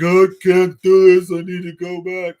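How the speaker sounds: high, loud panicky outburst; panicked. Retold or imitated. Seasick in the rocking boat, high loud panic.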